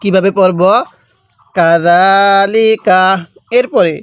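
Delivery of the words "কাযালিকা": slow, measured tajweed demonstration; elongated Quranic recitation. Shown as a demonstration. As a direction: draw out the long vowel in কাযালিকা. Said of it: "এক আলিফ টানতে হবে".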